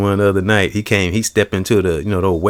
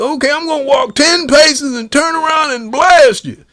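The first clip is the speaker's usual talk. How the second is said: much higher cartoon cowboy brag; Yosemite Sam. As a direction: crow each word like a cartoon gunslinger.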